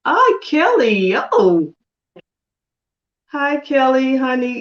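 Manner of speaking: high, loud, drawn-out hello; delighted greeting. Real, not acted. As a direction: call out to a dear friend you just spotted. Delighted greeting to a watching friend.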